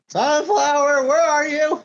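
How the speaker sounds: high, drawn-out call for a friend. Acted. Coming out of the barn looking for Sunflower, calling out. Player's character calls for hidden friend.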